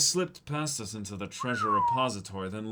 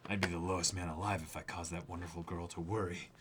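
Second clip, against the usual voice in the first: usual speaking voice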